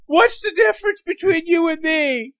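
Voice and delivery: high, loud mocking hero voice; hero taunt. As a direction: taunt a rival. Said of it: "go up to him and say".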